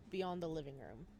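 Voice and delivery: normal talking voice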